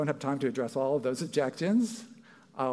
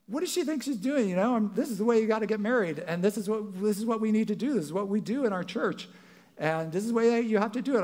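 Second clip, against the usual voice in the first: higher mock indignant rant; retold mad rant; 8 semitones higher than usual. Young pastor fuming about the bride, a higher mock indignant rant. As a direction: rant like an offended young minister. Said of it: "I was mad. I was like".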